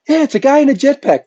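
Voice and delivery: high excited pilot voice. Retold or imitated. Pilots cheering the jetpack guy, high and excited. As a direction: sing it out high and thrilled.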